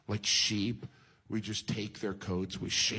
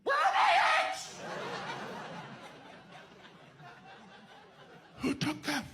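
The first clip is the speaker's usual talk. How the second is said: frantic hen. Retold or imitated. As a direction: screech for the stolen eggs. Hen discovers eggs missing.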